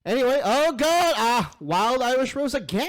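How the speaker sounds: high, loud exasperated oh God; exasperated groan. Real, not acted. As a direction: groan at the raider's name. Exasperated reaction.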